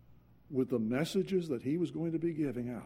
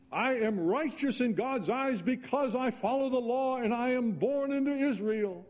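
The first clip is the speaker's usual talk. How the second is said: higher, louder pompous boast; proud Pharisee; 10 semitones higher, 4 dB louder than usual. Retold or imitated. Young Paul boasting of righteousness, higher and pompous. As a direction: puff up with self-righteous pride.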